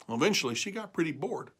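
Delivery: ordinary delivery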